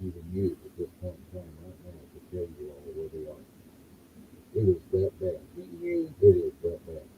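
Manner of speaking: muffled. Real, not acted. Host ranting away from the mic, muffled and distant. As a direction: talk from across the room, sound dulled. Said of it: "You sound a lot muffled".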